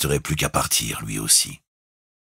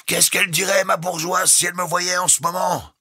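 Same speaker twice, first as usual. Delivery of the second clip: bosun's rueful shout. A narrator's reading. The bosun shouts to Jukes about his wife. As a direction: shout it with wry humour.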